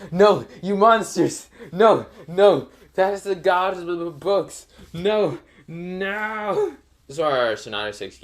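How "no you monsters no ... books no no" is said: high, wailing plea for the book; crying Kakashi. Acted. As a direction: howl it like a heartbroken fan. Kakashi begs over his burning book.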